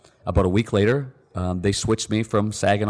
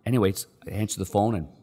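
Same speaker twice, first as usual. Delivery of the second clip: ordinary talk, nothing marked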